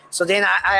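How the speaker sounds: normal talking voice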